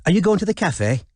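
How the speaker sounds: high, eager, bright question; bubbly posh girl voice; chirpy posh voice. Acted. Robin's put-on chirpy persona.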